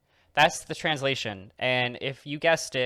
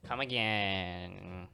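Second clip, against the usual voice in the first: mock shopkeeper farewell; 4 semitones lower, 6 dB quieter, much slower than usual.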